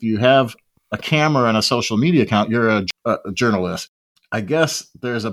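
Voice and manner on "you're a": ordinary talk, nothing marked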